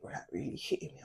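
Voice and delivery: whispered and quick; hushed. Real, not acted. Remembering the hurtful words, a quick hushed whisper. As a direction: drop to a whisper as it lands.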